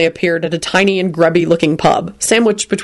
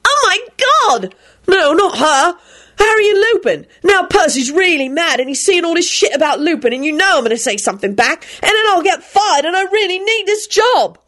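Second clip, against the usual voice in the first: high frantic pleading; panicked; 12 semitones higher than usual. A narrator's reading. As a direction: spill it all out in a frenzy. William panicking.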